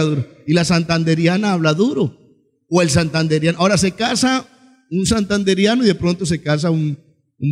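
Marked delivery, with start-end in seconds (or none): none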